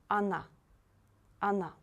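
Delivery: Она said "a-NA". Shown as a demonstration. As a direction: model Она correctly, opening on "ah". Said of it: "It's not correct".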